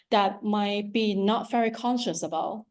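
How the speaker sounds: ordinary delivery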